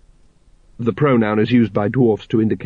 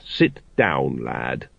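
put-on lower, soft old dwarf; gentle father; 11 semitones lower, 5 dB quieter, much slower than usual. A narrator's reading. A dwarf father gently asking his son to sit. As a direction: settle him down tenderly.

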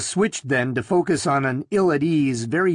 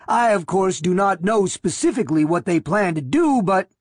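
higher, rasping thin man; nervous thin man, rasp it out; 6 semitones higher than usual. A narrator's reading. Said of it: "said in a rasping voice".

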